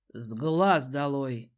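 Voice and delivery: low, slow man's voice; dismissive Desmond. A narrator's reading. Desmond agrees to banish the cousin, low and dismissive. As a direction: dismiss him in a deep, measured tone.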